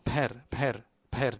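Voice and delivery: "per" with an h-like puff after the p; aspirated p. Shown as a demonstration. Teacher drills the often missed ha, breathy p in "per". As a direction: let air out on the p of "per". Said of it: "bunyi angin sikit".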